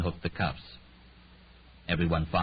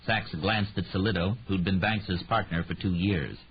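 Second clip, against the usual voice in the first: plain reading voice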